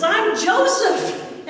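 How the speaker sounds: high, loud, slow cry of recognition; tearful outcry. Retold or imitated. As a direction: let it burst out of him. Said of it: "bursts out crying".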